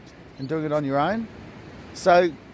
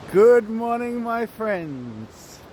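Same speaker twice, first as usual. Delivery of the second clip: called-out greeting; 6 semitones higher, 3 dB louder, much slower than usual. Real, not acted. Vlogger hailing his friends, drawn-out calling greeting. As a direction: sing out a long hello, then let it drop.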